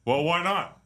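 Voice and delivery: indignant comeback in a caricature voice; George. Acted. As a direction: protest in a mock Jersey voice. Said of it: "George's like".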